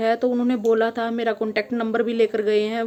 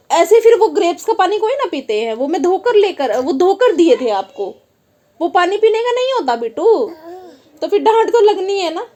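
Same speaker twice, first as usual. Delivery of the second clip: high, loud scolding explanation; mother explaining why she got angry; 7 semitones higher, 5 dB louder than usual. Real, not acted. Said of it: "फिर मैं गुस्सा आया".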